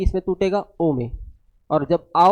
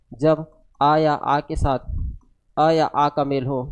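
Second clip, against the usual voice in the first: slow dictation; 2 semitones lower, slower than usual. Shown as a demonstration. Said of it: "दीर्घ स्वर संधि में क्या बनेगा?".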